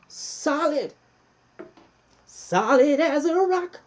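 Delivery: high and slow, loud on rock; emphatic. Real, not acted. Host urging viewers to watch properly, emphatic and high. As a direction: hammer home solid as a rock. Stressing a solid connection.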